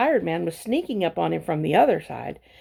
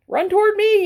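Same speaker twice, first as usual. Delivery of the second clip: much higher, loud yell; gander yelling; 14 semitones higher, 6 dB louder than usual. A narrator's reading. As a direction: holler like a frantic gander. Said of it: "yelled the gander".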